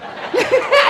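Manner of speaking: sudden high burst of laughter. Real, not acted. Laughs at own takbir joke. (tawa tinggi)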